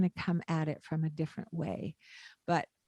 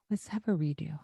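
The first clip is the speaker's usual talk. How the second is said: ordinary talk, nothing marked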